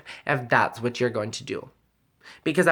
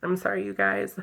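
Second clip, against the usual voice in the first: higher, softer, fighting back tears; upset apology; 5 semitones higher, 5 dB quieter than usual. Real, not acted. Apologizing to viewers mid-story, upset. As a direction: apologize quietly while choked up.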